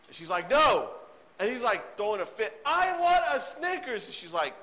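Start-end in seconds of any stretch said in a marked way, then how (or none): 0.5-0.9 firm mom no. Retold or imitated. Mom refusing the toddler. 2.7-4.1 put-on high, slow wailing child; toddler tantrum. Toddler throwing a fit at mom, high wailing whine.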